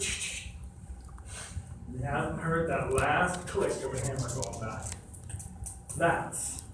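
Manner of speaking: slow, soft, almost whispered suspense; dramatic hush. Acted. Preacher hushing the room about the cocked hammer, softly and slowly. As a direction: draw them in with a hushed, slow build.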